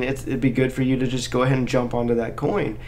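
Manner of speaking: ordinary delivery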